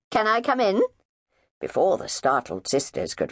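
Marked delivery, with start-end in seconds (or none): 0.1-0.8 high, bright and loud, blithe chirp; chirpy greeting. Acted. Neighbour barging in on the sisters, chirping blithely. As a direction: sing out the hello cheerfully.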